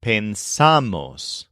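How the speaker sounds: pensamos stressed on the middle syllable, slowly; pen-SA-mos, drawn out on SA in pensamos; slow model of pensamos, weight after pens. Shown as a demonstration. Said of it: "the part after pens is accented".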